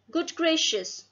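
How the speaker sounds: a touch of startled amazement; mild astonishment. A narrator's reading. Doctor reacting to parrot's bird language, mildly astonished. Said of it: "cried Doctor Dolittle".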